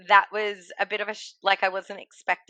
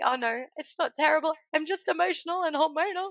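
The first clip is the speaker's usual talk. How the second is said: tearful quote; 9 semitones higher, faster than usual. Retold or imitated. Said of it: "I'm like".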